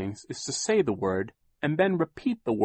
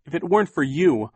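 fierce, louder and higher; 7 semitones higher, 7 dB louder than usual. A narrator's reading. Violet fiercely answering Captain Sham, louder. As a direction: throw his words back at him. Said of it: "Violet said fiercely".

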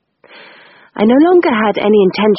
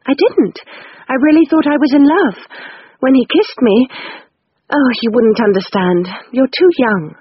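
put-on higher, wistful older sister; Rose's voice; 6 semitones higher than usual. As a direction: sigh it out like a girl in love. Rose voiced in story.

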